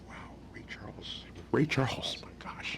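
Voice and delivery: breathless whisper of disbelief; awed whisper. Retold or imitated. Musician stunned at [name]'s offer, awed whisper. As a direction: whisper it, starstruck.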